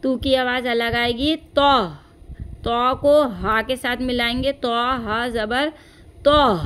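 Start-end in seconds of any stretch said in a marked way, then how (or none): 1.4-1.9 तो said heavy and loud. Shown as a demonstration. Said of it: "इस तरह से भारी आवाज़ आएगी". 6.2-6.6 तो said heavy and loud. Shown as a demonstration. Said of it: "इस तरह से भारी आवाज़ आएगी".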